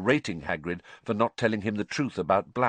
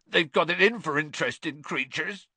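high, tearful complaint; choked up; 9 semitones higher than usual. A narrator's reading. Gamekeeper complains the committee hates his creatures, choked up. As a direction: protest through tears in a raised pitch. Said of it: "choked Hagrid, wiping his eyes".

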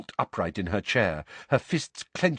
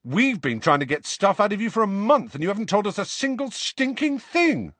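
indignant; 12 semitones higher, 5 dB louder than usual. A narrator's reading. George complains, raised.

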